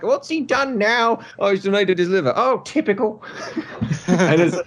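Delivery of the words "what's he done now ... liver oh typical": high-pitched, put-on wife voice; high comic complaining wife; exasperated wife. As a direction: play a fed-up wife, voice pitched way up. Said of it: "His wife shows up, and she's like".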